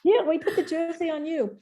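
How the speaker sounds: high, hurried mock French accent; hammed-up French official, high and loud; bad French accent. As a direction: correct someone urgently in a bad French accent. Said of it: "bad French accent".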